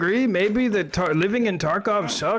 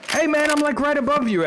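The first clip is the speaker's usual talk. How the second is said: pitched-up teasing call; high-pitched calling out; 8 semitones higher than usual. Pretend. Player calls to someone just below, high-pitched teasing.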